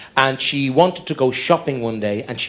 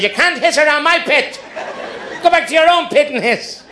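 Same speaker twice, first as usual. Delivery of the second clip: neighbour's roar; 12 semitones higher than usual. Retold or imitated. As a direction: bellow at the baby snakes like an angry neighbour. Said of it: "really lets a roar".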